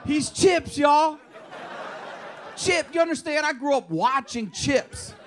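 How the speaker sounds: he can't believe it's the Chips cop; excited disbelief. Real, not acted. Comedian tells crowd about daughter's mother's boyfriend, excited disbelief.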